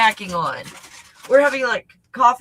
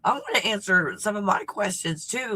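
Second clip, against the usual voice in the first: normal talking voice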